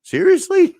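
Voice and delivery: high, loud disbelieving squeak; a kid suddenly done crying, stunned; incredulous kid. Retold or imitated. Dad imitates son reacting to news, high loud disbelief. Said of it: "he looked at me and he was like".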